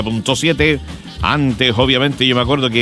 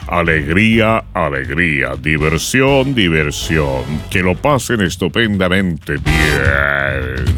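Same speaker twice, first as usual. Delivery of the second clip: low, restrained mock announcer delivery; old-time announcer without expression; 7 semitones lower, much slower than usual. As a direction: imitate stiff old radio, stretching the ending slowly. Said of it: "estaban todos hablando así". (locutor antiguo sin expresión)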